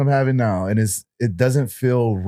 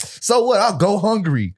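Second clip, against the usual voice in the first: defiant shrug; 9 semitones higher than usual. Retold or imitated. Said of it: "you're like".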